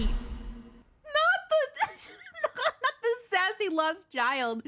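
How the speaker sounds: corrects through a laugh. Real, not acted. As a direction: giggle while correcting. Corrects partner while laughing.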